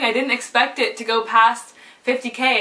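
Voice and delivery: ordinary delivery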